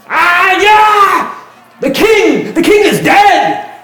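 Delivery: high, full-force street scream; panicked townsfolk yelling. Acted. Game master as townsfolk spreading news in streets, yelling. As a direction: cry the news out at the top of your voice. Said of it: "people running the streets yelling".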